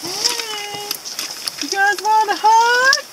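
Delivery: coaxing call. Real, not acted. Calling out to lambs.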